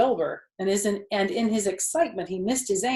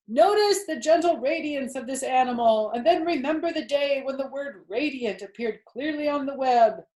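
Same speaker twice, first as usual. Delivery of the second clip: loud, high booming announcer; loudspeaker announcer; 5 semitones higher, 5 dB louder than usual. Acted. Fair announcer booming praise of Wilbur to the crowd. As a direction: proclaim to the whole fair.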